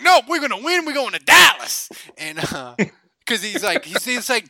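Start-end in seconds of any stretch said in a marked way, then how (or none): 0.0-1.9 high, loud stubborn cheer; defiant Jerry. Retold or imitated. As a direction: holler back as the overexcited friend who won't calm down. Jerry defiantly insists to mom.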